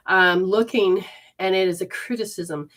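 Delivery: ordinary delivery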